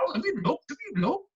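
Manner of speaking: a faint Irish lilt, not really landed; weak Irish accent. Retold or imitated. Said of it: "I can't do an Irish accent".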